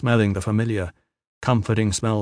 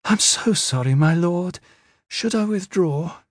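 put-on high, timid servant girl voice; meek young slave afraid of intruding; timorous; 8 semitones higher than usual. A narrator's reading. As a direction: apologise timidly in a raised, girlish pitch. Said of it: "a timorous voice intruded".

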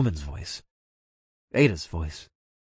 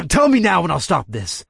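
threatening; 8 semitones higher, 5 dB louder than usual. A narrator's reading. Interrogating the Shadow Man.

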